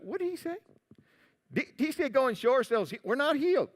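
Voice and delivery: high, hushed bewilderment; puzzled lepers. Acted. Lepers puzzled by a distant reply.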